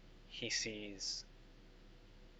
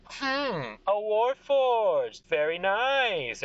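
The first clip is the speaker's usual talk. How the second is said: high, drawn-out royal admiration; admiring king; 11 semitones higher, 5 dB louder, much slower than usual. Acted. King sizing up a Warforged, admiring. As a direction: savour it slowly, high and loud. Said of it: "He goes".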